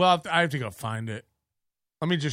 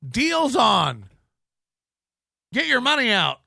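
usual speaking voice

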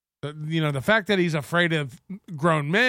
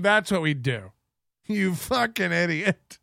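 ordinary talk

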